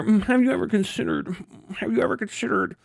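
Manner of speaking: soft, smug know-it-all voice; prissy pinky-out intellectual; pretentious. Retold or imitated. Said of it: "enlightened fucking pinky finger".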